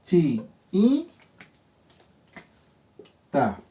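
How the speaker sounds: "Chi y ta" as three separate syllables with long pauses; split into syllables. Shown as a demonstration. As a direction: break "Chi y ta" apart for a class. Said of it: "se separan".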